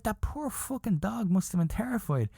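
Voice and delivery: high-pitched, aghast insistence; indignant pity. Retold or imitated. Comic insisting to her the dog suffered, indignant.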